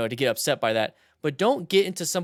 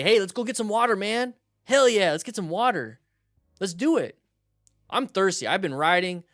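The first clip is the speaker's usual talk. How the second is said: higher-pitched, upbeat buddy invite; friendly coaxing; 5 semitones higher than usual. Shown as a demonstration. Rider coaxing a drunk person toward water, friendly invitation. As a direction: talk him into it like a pal. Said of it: "So what you can say".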